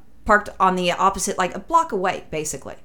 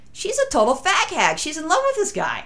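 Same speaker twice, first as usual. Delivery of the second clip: catty gossip; 10 semitones higher than usual. Retold or imitated. Narrator voicing own catty remark.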